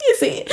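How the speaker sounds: high, voiceless sobbing gasps; mock crying gasps. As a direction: gasp as if bursting into tears, hammed up. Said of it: "he starts crying like".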